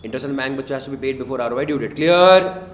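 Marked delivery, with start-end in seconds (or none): 1.9-2.7 loud check-in. Real, not acted. Teacher checks class understands.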